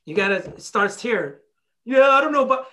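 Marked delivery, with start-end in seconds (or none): 1.8-2.7 louder, higher imitation of a doubter; mock wavering objection. Depressed person told it starts here, mock protesting. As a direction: push back with a loud, hesitant excuse.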